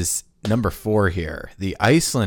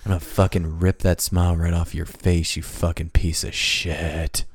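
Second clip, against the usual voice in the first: growling villain threat; 6 semitones lower than usual. Retold or imitated. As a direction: threaten him through the teeth. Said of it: "I feel attacked".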